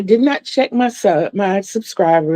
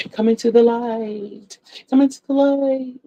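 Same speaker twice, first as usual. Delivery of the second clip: higher, hushed spooky beckoning; mock ghostly call; 4 semitones higher than usual. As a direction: lure them in like a ghost. Playful ghostly beckoning about lighting.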